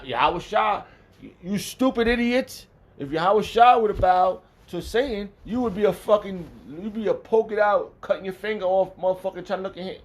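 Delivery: loud, high-pitched furious tirade; angry rant. Real, not acted. Preacher berating fools over Satan's bargain, loud and furious. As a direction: lay into them at full volume. Ranting at those who doubt.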